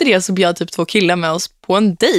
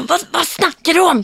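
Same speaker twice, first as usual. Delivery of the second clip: almost yelled; 9 semitones higher than usual. Retold or imitated. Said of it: "Skrek nästan".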